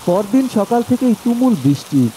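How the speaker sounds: ordinary delivery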